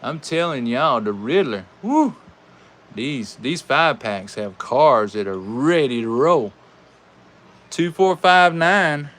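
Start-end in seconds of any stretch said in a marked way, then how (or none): 1.8-2.2 much higher whooping cheer; woo cheer. Real, not acted. Host celebrating the winning car, loud woo. 7.7-9.1 louder, higher, slow number call; emphatic time call. Real, not acted. Host announcing the time to beat, emphatic. Calling the new record time.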